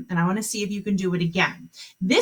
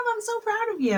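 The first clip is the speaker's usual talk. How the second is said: praise; 14 semitones higher, 4 dB quieter, faster than usual. Real, not acted. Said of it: "praising the students".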